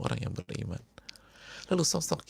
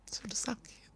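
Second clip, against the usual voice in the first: higher, soft broken whisper; whimpering; 10 semitones higher, 10 dB quieter than usual. Acted. Sick friend weeping to a companion, whispered. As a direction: let the voice crack into a whisper. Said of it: "sambil nangis dia bilang".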